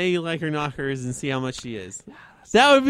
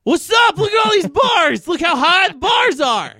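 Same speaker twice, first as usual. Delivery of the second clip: high mock yelling kid, slower at the end; mock shout; 13 semitones higher, 9 dB louder than usual. Mocking a bragging kid with a high shout. Kid mocked at the water park.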